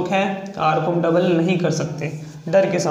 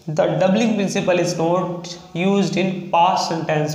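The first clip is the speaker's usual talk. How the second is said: slow dictation; much slower than usual. Shown as a demonstration. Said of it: "तो seventy one number point है आपका".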